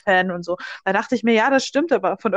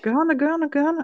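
higher moaning zombie groan; 6 semitones higher than usual. Pretend. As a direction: moan for brains like a zombie. Zombie craving brains after Gehirne. (stöhnende Zombie-Stimme)

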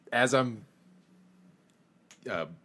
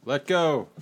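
calling out; 3 semitones higher, 6 dB louder than usual. Acted. Pulled in, calls back to others.

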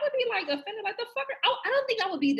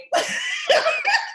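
high shrieking laugh; 3 dB louder than usual. Real, not acted. Reacting to the joke.

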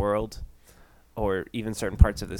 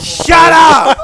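high, explosive comic yell; mock outrage shout; 13 dB louder than usual. Host shutting Levi down in mock outrage, shouting high and loud. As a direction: bellow it in hammed-up outrage.

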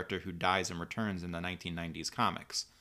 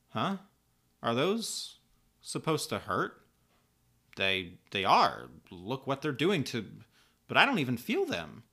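put-on higher-pitched, puzzled young heroine; confused; 3 semitones higher, 3 dB louder than usual. A narrator's reading. Kara puzzled by the laser fire, confused. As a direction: ask it baffled, in halting bursts. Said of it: "only manages to briefly confuse her".

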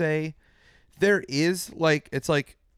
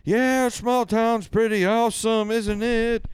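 high, slow mock country-star voice; 8 semitones higher, 3 dB louder, slower than usual. Mocking the singer praising small towns, high drawn-out impression. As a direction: pitch it up and stretch it, mocking. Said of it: "[name]'s like".

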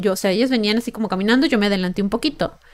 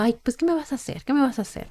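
imitating his taunting sneer, softer; mocking taunt; 4 semitones higher, 8 dB quieter, faster than usual. The flasher taunting the narrator who confronted him, soft mocking sneer. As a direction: mimic a smug, mocking dare. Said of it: "como en tono de burla".

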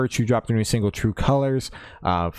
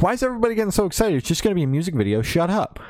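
high, fast dismissive rant; mock annoyed rant; 10 semitones higher, faster than usual. Fan brushing off the hype over a video, fast and high. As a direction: rattle it off like you're fed up. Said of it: "I was like".

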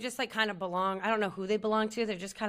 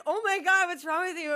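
acted alarm; 7 semitones higher, 3 dB louder than usual. Friend reacts to her sobbing.

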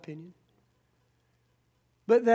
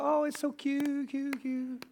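mock cutesy; 5 semitones higher, 4 dB quieter, slower than usual. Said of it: "and we go".

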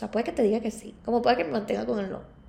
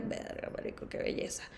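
unvoiced hushed amazement; whispered awe; 17 dB quieter than usual. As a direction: breathe it out in awe. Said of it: "esta gente que tú digas".

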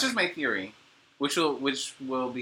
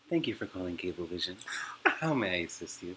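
lower, smooth phone greeting sinking into a whisper; buttery phone voice; 5 semitones lower than usual. Pretend. Imagined Cablevision agent greeting caller, low buttery whisper. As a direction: purr the greeting like a smooth operator. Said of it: "buttery ass voice like that".

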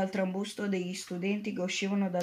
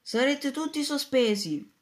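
higher, louder stern shout; teacher shouting; 5 semitones higher, 4 dB louder than usual. A narrator's reading. Teacher still shouting at pupils. (la prof grida forte e severa)

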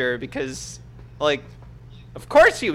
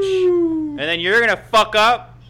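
loud, slow wrestler rant; wrestling promo; 7 semitones higher, 6 dB louder, slower than usual. Acted. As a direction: drag out the threat like a heel. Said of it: "[name] cutting a promo on Philly".

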